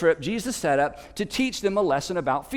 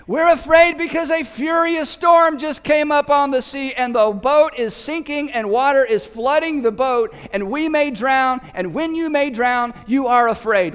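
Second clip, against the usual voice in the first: exasperated yell; 6 semitones higher, 4 dB louder than usual. Retold or imitated. Disciples answer over the storm.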